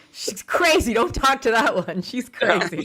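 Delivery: wag a finger and whisper-warn a friend, hammed-up; lightly voiced grandma telling others to avoid her; grandma's warning. Voicing grandma about the stranger.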